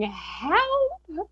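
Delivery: high, drawn-out mock wail; cry for help. Messaging a psychic friend for help, mock-desperate high call.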